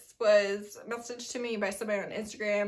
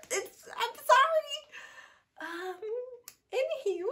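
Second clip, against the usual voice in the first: sheepish squeak; 13 semitones higher than usual. Real, not acted. Embarrassed she forgot the name.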